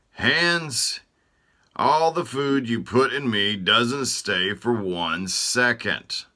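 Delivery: high, loud complaining voice; Throat complaining. Acted. Throat griping to Hands about greedy Stomach, high and loud. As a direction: whine it like a put-upon body part.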